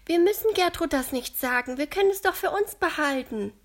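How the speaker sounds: leicht gespielte Mädchenstimme, höher und lauter; Lotta voiced. A narrator's reading. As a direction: als eifriges Mädchen Lotta, etwas höher. Lotta's voice in the story. (Lotta schlägt den Freunden vor, helle Kinderstimme)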